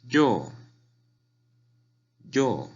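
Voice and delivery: "yo" starting high and falling sharply; fourth tone. Shown as a demonstration. Teacher showing "yo" in fourth tone, high fall. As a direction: drop "yo" firmly from the top. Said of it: "Cuarto tono sería".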